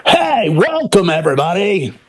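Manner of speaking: a put-on showman hello; mock stage host greeting. Shown as a demonstration. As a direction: play the cheesy stage persona greeting the crowd. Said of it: "I don't have a".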